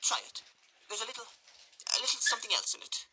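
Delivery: high, coaxing lady's voice; coy matron. Acted. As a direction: coax him slyly with the drink.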